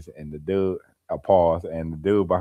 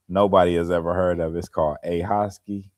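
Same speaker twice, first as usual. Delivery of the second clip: usual speaking voice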